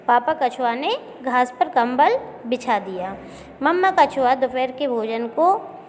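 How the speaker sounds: normal talking voice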